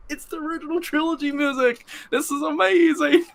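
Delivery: very high, mock hollering in delight; mock inner scream. Fan screaming in his head at the music, squealing mock holler. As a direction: squeal it like a thrilled kid. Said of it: "I'm, like, screaming and hollering".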